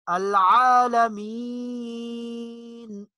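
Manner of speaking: العالمین slowly, the ی drawn long; العالمین, stretch the yaa sound; slow chanted العالمین. Shown as a demonstration. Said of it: "کھینچ کر تھوڑا سا لمبا کر کے پڑھیں گے".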